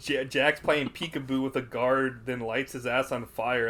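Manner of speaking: ordinary delivery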